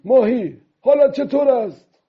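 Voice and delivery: high-pitched loud call-out. A narrator's reading. Old man loudly hailing the fish he is towed by, calling out. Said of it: "با صدای بلند پرسید".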